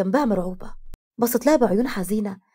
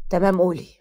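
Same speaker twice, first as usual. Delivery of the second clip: lightly voiced man, pitch dropped; low, even "go on"; lower-voiced reply; 3 semitones lower than usual. A narrator's reading. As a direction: answer in a lower, steady voice. Second sibling answers in chat.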